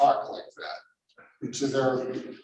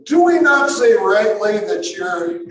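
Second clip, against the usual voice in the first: indignant accusers; 5 semitones higher, 7 dB louder than usual. Acted.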